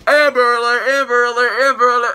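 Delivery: loud, high-pitched repeated chant; hammed-up high yell; mock alarm shout. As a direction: blare it out like a mock siren. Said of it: "I'm walking up to you".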